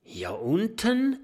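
high, rising little character voice; puzzled question. A narrator's reading. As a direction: ask it high and incredulous. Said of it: "fragt Oskar".

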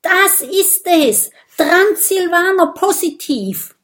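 high, loud triumphant cry; excited shout. A narrator's reading. Bisbert jumps up after tasting the blood, jubilant shout. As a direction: call out the great discovery. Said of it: "Er springt auf und ruft".

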